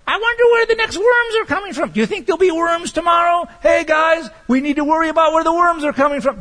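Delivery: high, loud, fretful bird voice calling to the flock; squeaky hammed-up raven in a panic; worried raven. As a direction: play an anxious raven shouting to his friends.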